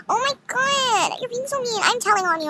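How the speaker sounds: high, stretched-out tattletale whine; whiny mimicry. Losing girl threatens to tell on her rival, high mocking whine.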